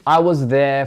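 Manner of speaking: "there" without R, slow British imitation; British accent. Shown as a demonstration. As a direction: echo her British "I was there from". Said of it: "there, esa R no se pronuncia".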